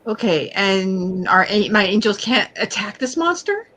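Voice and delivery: normal talking voice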